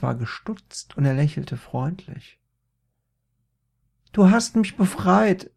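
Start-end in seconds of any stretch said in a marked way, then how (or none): 4.1-5.5 befreiter Jubel. A narrator's reading.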